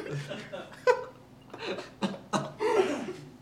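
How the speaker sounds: long, high, breathy laugh; laughter. Real, not acted. Picturing getting kicked out of the panel, long high laughter. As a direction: dissolve into laughter.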